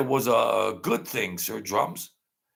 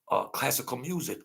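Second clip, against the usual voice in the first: ordinary talk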